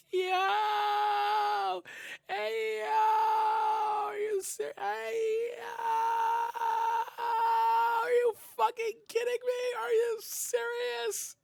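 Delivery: high strained yell of disbelief, almost crying; exasperated shout. Real, not acted. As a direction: scream it out like you can't believe what you're hearing. Disbelief at the dictator claim.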